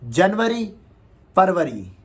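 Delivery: जनवरी and फरवरी with full Urdu r and w; mock the desi way of saying the months; the wrong way to say them; Urdu-style wrong take. Shown as a demonstration.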